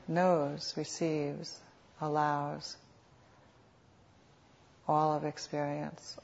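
unhurried meditation guide, long pauses between phrases; guided meditation. Real, not acted. Teacher guiding sitters to notice what is happening, calm meditation voice. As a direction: lead them gently, phrase by phrase, leaving space.

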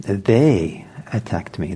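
"they" leaned on, said slowly; stress on THEY. Shown as a demonstration. Teacher stressing who attacked, weight on "they". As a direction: hit the word they before attacked me. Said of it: "the emphasis is important".

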